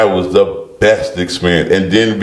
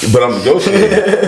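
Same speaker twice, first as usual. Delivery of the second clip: laughing protest; 10 semitones higher than usual. Retold or imitated. Retold himself protesting on the phone.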